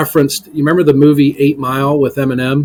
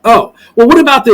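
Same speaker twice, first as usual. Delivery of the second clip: high, loud mock attorney objection; imitated attorney, pitched well up; questioning attorney; 9 semitones higher, 7 dB louder than usual. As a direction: act out a lawyer raising another objection. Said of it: "when they went to the attorney".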